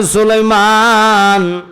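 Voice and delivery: calling out. Retold or imitated. Azrael hails Suleiman in the preacher's tale, calling out. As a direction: cry out the name, long and ringing.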